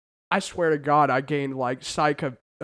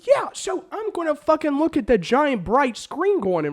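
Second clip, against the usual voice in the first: worked-up, higher-pitched complaint; heated rant; 7 semitones higher than usual. Real, not acted. Ranting to co-host about phone blocking paid view, heated. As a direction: argue it hotly, with indignation. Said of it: "I love how heated you are over this".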